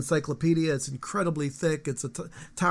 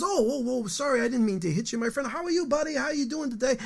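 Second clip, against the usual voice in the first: higher, lightly voiced cheerful pet talk; friendly to the dog; 7 semitones higher than usual. Man in a better mood greeting his dog, lightly warm and higher. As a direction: coo at the dog like an old buddy. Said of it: "And the guy goes".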